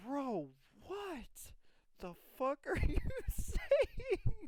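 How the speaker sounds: mock disbelief that climbs into a laughing squeal; incredulous bro. Retelling the guests' reaction, rising laughing disbelief. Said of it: "everyone's just like".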